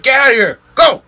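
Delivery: high, loud shout to scare them off; yelling. Retold or imitated. Camper yelling to scare off coyotes, high loud shout. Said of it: "stopping and yelling".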